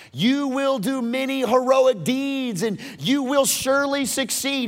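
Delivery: high, ringing proclamation; proclaimed blessing. Acted. Saul blessing his son David, proclaiming it high. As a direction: send the blessing out loud and high.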